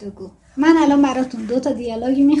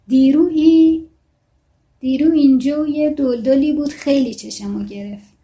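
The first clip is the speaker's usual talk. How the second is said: a Shirazi speaker chatting, read lightly; reading a Shirazi character's line in a mild accent; 3 semitones higher, 6 dB louder than usual. Acted. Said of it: "بازم لهجهٔ شیرازی رو داره".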